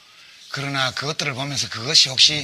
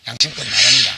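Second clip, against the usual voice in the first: emphatic loud declaration; 9 dB louder than usual. Real, not acted. Closing debate declaration.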